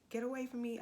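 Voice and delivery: high, loud desperate yell; retold scream. Screaming high at the attacker holding her. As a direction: yell it to push him off. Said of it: "just started screaming".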